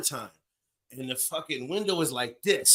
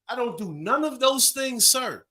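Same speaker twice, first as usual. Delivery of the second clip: mock innocent; 7 semitones higher than usual. Playing innocent to police.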